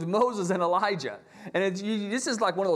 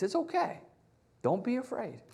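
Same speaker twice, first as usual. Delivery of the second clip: much higher, softer reassurance; compassionate comfort; 5 semitones higher, 4 dB quieter than usual. Pretend. Jesus comforting his frightened disciples, high and soft. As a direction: comfort them tenderly. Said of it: "in mercy and compassion".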